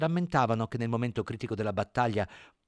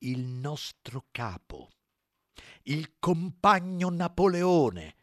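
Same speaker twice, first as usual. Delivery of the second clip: very slow, firm and deliberate; 6 semitones higher, 5 dB louder, much slower than usual. Acted. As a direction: lay down each word slowly. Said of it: "parlando molto lentamente e con fermezza".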